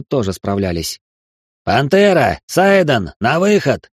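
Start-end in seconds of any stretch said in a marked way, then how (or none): 1.6-3.9 loud summons to the arena; calling out. Acted. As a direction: call them out loudly. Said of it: "крикнул Арранкар".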